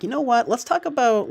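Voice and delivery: mock suggestion. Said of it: "If you're kinda like".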